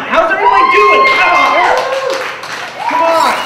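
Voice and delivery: high, loud call to the audience; crowd-hyping shout. Real, not acted. Comic hypes up the crowd.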